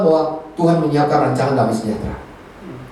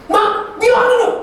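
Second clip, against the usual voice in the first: high-pitched mock tantrum; retold angry complaint. Preacher replays complaining to mum after the phone fight, mock angry. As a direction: act out griping angrily to mum. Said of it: "dia begitu udah marah-marah".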